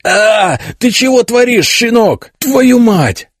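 put-on a high, furious roar at the boy. A narrator's reading. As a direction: bellow it out, outraged. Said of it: "заревел князь". (высокий яростный рёв)

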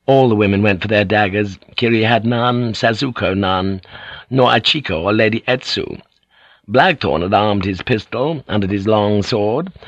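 ordinary delivery

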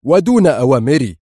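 loud, sharp outburst. A narrator's reading. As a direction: raise your voice in sharp reproach. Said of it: "ثم ارتفع صوته وهو يضيف في حدة".

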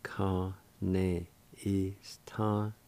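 Kane istanagi spoken slowly and softly; slow demo. Shown as a demonstration. Teacher modelling the word slowly first. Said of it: "it's pronounced like this".

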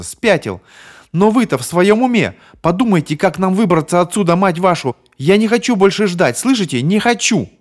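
high, loud, desperate screaming; hysterical yelling. Acted. Bonnie screams she won't wait, hysterical and loud. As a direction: demand they get out now.

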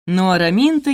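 ordinary delivery

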